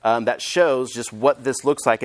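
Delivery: ordinary delivery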